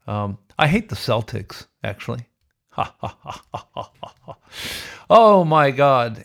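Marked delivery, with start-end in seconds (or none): 2.7-4.4 soft unvoiced chuckle; quiet breathy ha ha ha; breathy laugh. Real, not acted. Laugh after hating Celtics. 5.1-6.2 slow, loud oh my God; loud exclamation. Real, not acted. Loud exclamation after laugh.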